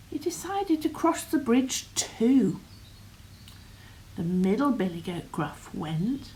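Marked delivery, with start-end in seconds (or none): none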